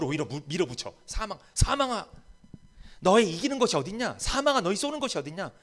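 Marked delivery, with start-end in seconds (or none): 1.1-5.5 bold taunt. Retold or imitated. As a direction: push death back with daring scorn. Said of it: "이렇게 담대하게 이야기하는".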